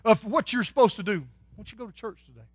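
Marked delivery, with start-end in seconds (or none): 1.2-2.5 a soft, gentle nudge, much softer; quietly urging, almost a whisper; soft small voice. Acted. Holy Spirit nudging a wanderer, softly urging. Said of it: "He whispers in a soft, small voice".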